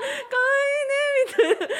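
high, loud mock cooing that breaks into laughter; laughing, hammed-up adoration; gushing through laughter. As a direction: squeal it fondly, then crack up. Gushing imitation dissolving in laughter.

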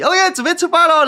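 哥哥兴奋出发; excited cheer. Retold or imitated. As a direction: cheer like a kid starting a ride. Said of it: "然后我们就骑".

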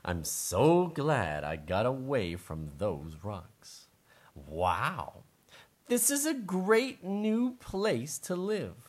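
happy mole. Acted. Mole admiring his new place to live, slow pleased character voice. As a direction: savor each phrase slowly as the mole. Mole's speech in story.

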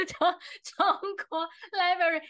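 can't get the pun out for laughing. Real, not acted. Host retelling pun to listeners, laughing. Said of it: "大家听得懂吗？".

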